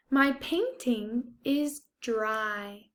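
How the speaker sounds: ordinary talk, nothing marked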